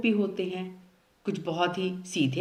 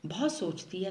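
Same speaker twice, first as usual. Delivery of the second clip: usual speaking voice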